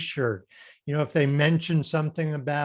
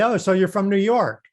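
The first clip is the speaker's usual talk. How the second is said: playful teasing; 6 semitones higher, 6 dB louder than usual. Pretend. Teasing a guest with Southern accent.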